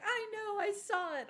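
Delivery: high, loud mock wail; crying child. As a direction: imitate a distraught kid wailing back. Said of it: "they're crying".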